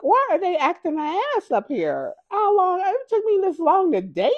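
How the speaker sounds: high, loud disbelief; exasperated retelling. Retold or imitated. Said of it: "I was like".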